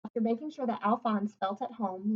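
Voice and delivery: ordinary delivery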